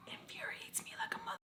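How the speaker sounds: breathy whisper under the breath; whispered fury. Real, not acted. Ranting to viewers, drops into a fuming whisper. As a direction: hiss it to yourself, furious but hushed.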